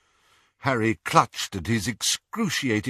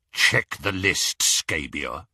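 gloating werewolf; 3 semitones lower, slower than usual. Acted. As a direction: savour every word like prey. Greyback orders.

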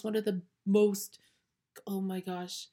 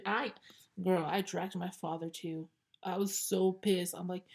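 normal talking voice

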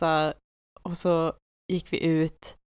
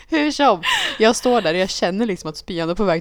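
normal talking voice

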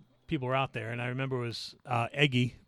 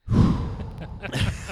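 long exasperated sigh, breathy and high; retold fed-up sigh; loud sigh; 6 semitones higher, 4 dB louder than usual. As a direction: sigh like you can't be bothered. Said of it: "I went".

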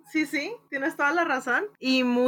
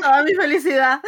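laughing; 5 semitones higher, 6 dB louder, faster than usual. Real, not acted. Laughing with delight at the topic.

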